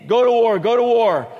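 loud, high repeated chant; mimicked rallying cry. Four hundred prophets urging the king, loud rallying chant. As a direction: belt it out like a crowd urging battle. All the prophets chorusing together.

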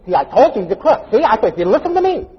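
high, loud nagging gloat; mock I-told-you-so. Wife to husband who lost the money, gloating I-told-you-so.

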